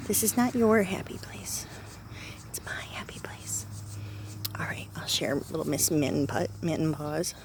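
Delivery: hushed teasing whisper to a cat. Real, not acted. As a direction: whisper it playfully, just for the cat.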